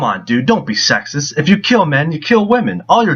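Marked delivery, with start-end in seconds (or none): none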